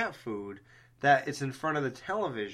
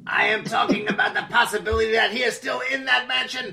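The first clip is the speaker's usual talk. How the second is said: loud, higher, laughing mock proclamation; mock dramatic shout; 8 semitones higher, 8 dB louder than usual. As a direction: proclaim it like a horror trailer, laughing. Mock dramatic horror claim.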